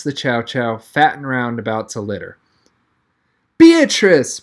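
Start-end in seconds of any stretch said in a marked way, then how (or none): 3.6-4.4 high, loud emotional cry of the dog's name; cried out. Acted. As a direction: burst out with joy at the sight. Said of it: "Brad cried".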